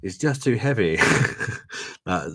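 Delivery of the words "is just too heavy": mock translation. Shown as a demonstration.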